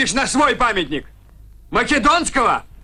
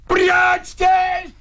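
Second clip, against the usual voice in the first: high, drawn-out warning shout; urgent shout; 4 semitones higher than usual. Acted. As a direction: yell for everyone to take cover. Ordering all to hide. (протяжный крик тревоги)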